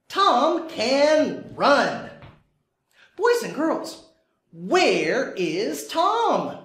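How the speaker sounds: slow, sing-song picture book voice; reading to a toddler. Acted. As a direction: read slowly to little kids. Teacher reading story to kids.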